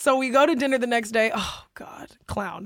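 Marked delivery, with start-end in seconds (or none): 1.3-2.6 breathy unvoiced groan; whispering. Real, not acted. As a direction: sigh it under your breath. Unvoiced exasperated aside.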